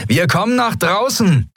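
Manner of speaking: high, carrying call to the pirates. A narrator's reading. Sephiron calls out to the waiting pirates, high and carrying. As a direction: call it out across the hangar. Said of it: "rief Sephiron den Yadi-Piraten zu".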